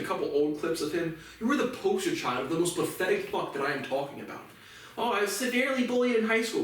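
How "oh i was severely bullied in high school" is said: high-pitched mocking quote; Ben Shapiro, mocked. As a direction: throw his victim claim back at him, pitched up. Mocking Ben Shapiro's bullying claim.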